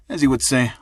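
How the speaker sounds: plain reading voice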